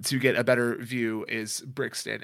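ordinary delivery

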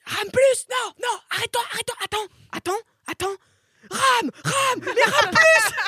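loud high shouted commands; coach yelling. Retold or imitated. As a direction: yell orders at a surfer, laughing. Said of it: "il te hurle dessus".

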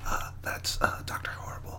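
soft whisper. Real, not acted. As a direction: whisper the title. Whispered chat before recording.

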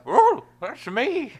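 very high, slow cartoon dog voice; Scooby voice. Answers to Scooby Doo.

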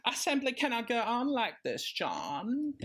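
high dramatic despair; mock Moira to her husband; Moira impression. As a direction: wail it like a soap diva. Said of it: "can you do a good Moira".

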